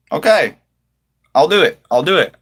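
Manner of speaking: ordinary delivery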